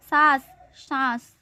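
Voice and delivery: सास then सांस with a nasalised aa, slow; wrong then right. Shown as a demonstration. Teacher contrasts सास and सांस for class, slowly. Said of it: "अनुस्वार या चंद्र बिंदु लगाएं".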